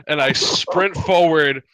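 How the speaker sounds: ordinary delivery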